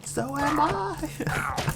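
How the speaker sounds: high, slow, dismayed cartoon kid voice; Milhouse's reply. Milhouse answering Bart, high and slow. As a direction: stretch it out, wide-eyed and high. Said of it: "From The Simpsons".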